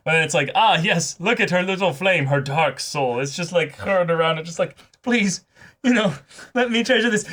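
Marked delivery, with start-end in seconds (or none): 0.5-3.2 hammed-up, higher awed voice; mock dramatic admirer. Voicing an admirer gushing over the heroine, hammed-up. 5.0-7.2 mock pleading. Begging to treasure the heroine, high hammed-up plea.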